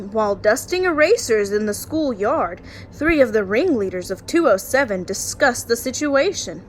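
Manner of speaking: lively storytime read-aloud; story narration. Acted. Teacher reading a bedtime picture book to her class, lively storytime voice.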